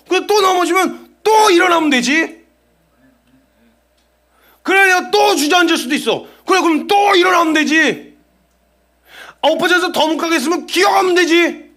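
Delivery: loud, high, unbowed retort; defiant proclamation. Acted. Believer answering Satan's taunts, in a loud defiant voice. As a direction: shout back at the accuser.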